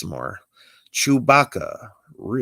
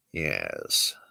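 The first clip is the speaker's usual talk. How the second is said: much lower, whispered yes; 13 semitones lower, 8 dB quieter than usual. Acted. Obi-Wan answering Catman, low whisper. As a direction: hush it out low.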